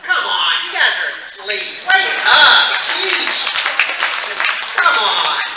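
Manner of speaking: loud, joking wake-up call; mock exasperation. Real, not acted. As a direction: rouse a sleepy audience loudly. Scolds audience for missing joke.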